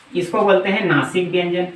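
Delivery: ordinary delivery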